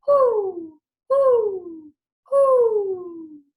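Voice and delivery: high, loud hooting owl call; Mother Owl hooting toward the east. Acted. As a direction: hoot to wake the sun.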